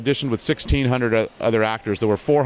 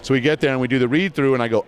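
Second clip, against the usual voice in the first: usual speaking voice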